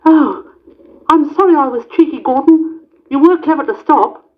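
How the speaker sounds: high, very fast apology; sorry Percy. A narrator's reading. Percy apologising to Gordon, high fast voice. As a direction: blurt the apology out.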